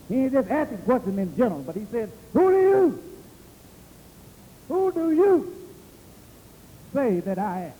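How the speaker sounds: high, driving preacher delivery; preaching. Acted. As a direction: preach it, pitching WHO DO YOU high. Preaches at full force.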